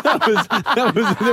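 laughing. Real, not acted. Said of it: "laughing at Buzz's impression".

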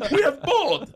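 high, laughing announcement; buddy confirming the boat, high and delighted; excited and laughing. Retold or imitated. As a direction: crow it with glee. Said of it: "He's like".

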